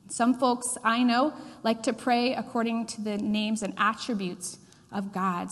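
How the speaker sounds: normal talking voice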